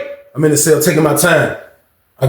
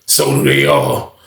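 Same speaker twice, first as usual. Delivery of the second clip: much lower and slow, sly drawl; knowing guard; slower than usual. Retold or imitated. Guard slyly agreeing, very low and slow. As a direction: drop it deep and slow, in on the joke.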